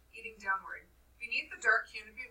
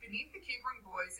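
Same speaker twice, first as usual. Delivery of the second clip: ordinary delivery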